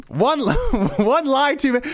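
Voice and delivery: high-pitched disbelief with a laugh; incredulous. Real, not acted. Host scoffing at the tweet's wording, incredulous. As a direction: laugh at the absurdity as you repeat it.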